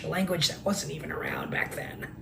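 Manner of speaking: conspiratorial whispered aside; mock whisper. Mocking commenter whispering a conspiracy detail to him. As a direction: lean in and whisper like sharing a secret.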